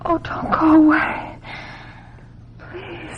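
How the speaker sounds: a tearful high plea that fades away; pleading cry. Acted. Marcy begging Paul to stay, pleading through tears. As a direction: beg him to stay, near tears.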